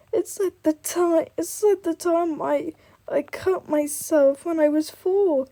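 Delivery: high, slow, hammed-up tearful voice; mock bawling. Girl explaining her tears over a paper cut, mock bawling. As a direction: pretend to wail, pitched high and drawn out. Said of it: "she starts bawling her eyes out".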